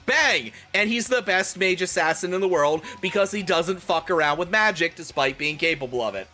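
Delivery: usual speaking voice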